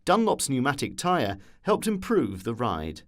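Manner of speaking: plain reading voice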